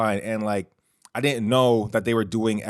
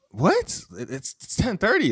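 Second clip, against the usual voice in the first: high-pitched, stammering mock disbelief; retold disbelief; 9 semitones higher, slower than usual. Hungry tourist to waiter about closed places, mildly incredulous. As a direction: replay your baffled protest, voice jumping up.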